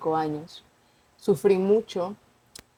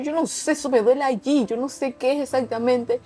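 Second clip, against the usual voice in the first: panicked; 6 semitones higher than usual. Retold or imitated. Retells her panicked answer to mom.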